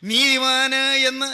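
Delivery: sustained, drawn-out preacher delivery; forceful preaching. Real, not acted. Preacher to congregation on being counted righteous, forceful preaching. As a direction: proclaim it with stretched, ringing phrases.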